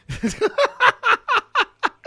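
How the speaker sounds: loud high-pitched laugh; high burst of laughter. Real, not acted. Laughs about obsessing over deck.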